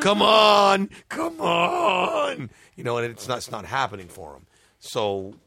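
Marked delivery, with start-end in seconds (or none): 0.0-2.5 much higher, louder Come on straining shout. Retold or imitated. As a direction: yell it like forcing a power that won't come. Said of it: "He's like".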